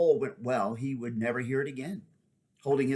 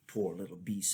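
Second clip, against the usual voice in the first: softer, gentle and kind; gentler tone; 4 semitones lower, 6 dB quieter than usual. A narrator's reading. Horse gently comforting the frightened boy, softer. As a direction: ease off into tenderness. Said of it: "said the horse in a gentler tone".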